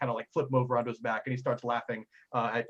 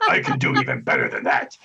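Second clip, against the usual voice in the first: much lower, loud big-man voice; boasting giant; 13 semitones lower, 6 dB louder than usual. Acted. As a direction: brag like a hulking strongman. Giant boasts of his strength.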